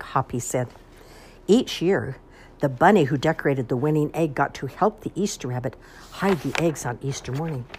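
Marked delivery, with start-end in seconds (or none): none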